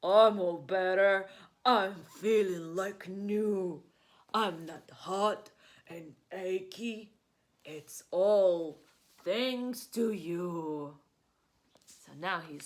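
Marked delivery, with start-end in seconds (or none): 0.0-11.0 loud, slow bear voice calling out; bear cries out. Acted. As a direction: Bear shouting his thanks to friends. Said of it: "Bear cries".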